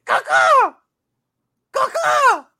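high crow caw shout; chirping bird person. Acted. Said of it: "He has a case of the cawing today".

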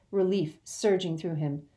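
ordinary delivery, nothing marked

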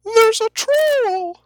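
very high falsetto alarm; squeaky herald crying out a warning, drawn out; guild crier. Acted. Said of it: "Guild Crier enters".

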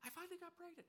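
very high squeaky gossip voice; high-pitched girlish chatter; excited friend. Retold or imitated. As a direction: squeal the news like a giddy friend. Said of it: "in her circle of friends".

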